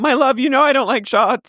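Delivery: sugary put-on girlfriend voice; mock sweet girlfriend. Co-host imitating Nia dodging the shot, high cooing mock voice.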